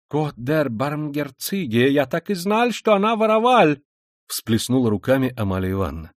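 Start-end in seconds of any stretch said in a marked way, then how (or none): 0.1-3.8 put-on German-accented landlady. Landlady seeing her suspicion confirmed, indignant German accent. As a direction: cry out in German-accented Russian.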